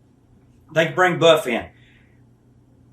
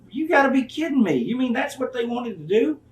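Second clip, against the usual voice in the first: high, incredulous retelling; disbelief; 8 semitones higher than usual. Retold or imitated. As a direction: act stunned at the news. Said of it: "I said".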